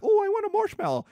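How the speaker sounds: put-on high-pitched, quick little kid voice; excited child. Nephews by the campfire asking for a treat, high child voice. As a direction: pipe up high like an eager child. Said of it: "They're like".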